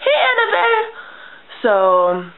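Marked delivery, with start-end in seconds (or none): 0.0-0.8 much higher call across the yard. Retold or imitated. Child calling to her friend from the window, high call. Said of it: "I'd be like".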